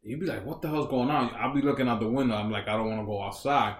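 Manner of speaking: ordinary talk, nothing marked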